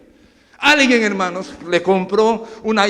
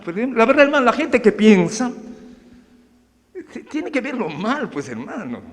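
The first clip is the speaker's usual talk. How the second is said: normal talking voice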